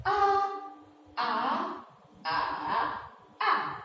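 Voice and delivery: A in the four tones in turn; four Mandarin tones on A, repeated; all four tones. Shown as a demonstration. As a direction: run A through flat, rise, dip and fall. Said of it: "Come with me again".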